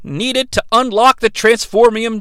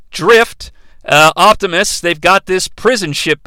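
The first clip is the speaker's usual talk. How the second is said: ordinary talk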